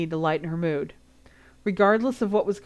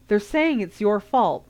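demanding; 4 semitones higher, 5 dB louder than usual. A narrator's reading. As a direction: press him hard for an answer. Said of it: "Ron demanded".

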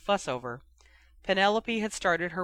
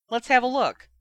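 high, loud eager request; 8 semitones higher, 7 dB louder than usual. A narrator's reading. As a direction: reach for it keenly. Curious brother.

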